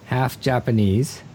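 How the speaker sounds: ordinary delivery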